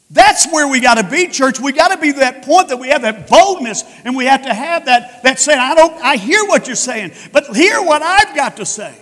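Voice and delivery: loud, rising preacher's cry; fiery preaching. Acted. Preacher shouting Stephen's defiance to his stoners, loud and forceful.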